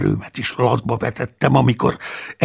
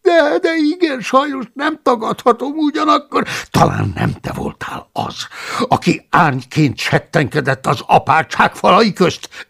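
protesting; 10 semitones higher, 3 dB louder than usual. Acted. The cellarius protesting innocence to Paulus, whining. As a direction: protest your innocence in a high whine.

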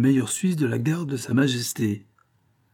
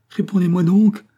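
loud, high plea rising at the end; desperate cry; 8 semitones higher, 8 dB louder than usual. A narrator's reading. Sobbing daughter begging her father to answer, desperate cry. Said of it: "s'écria-t-elle".

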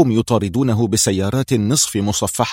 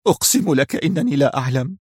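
mild tearful plea, pitched up; a touch of weeping desperation; tearful breakdown; 4 semitones higher than usual. A narrator's reading. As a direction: plead as if close to collapse. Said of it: "بكى بيزو في انهيار".

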